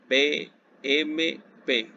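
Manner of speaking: "B M P" said slowly, letter by letter; naming the letters "B M P" one by one for the class; spelled out. Shown as a demonstration. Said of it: "letters contrasting the rhymes".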